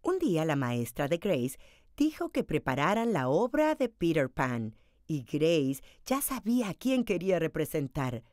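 usual speaking voice

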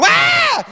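high-pitched panicked "Ah!"; mock freak-out scream. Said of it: "they're freaking out".

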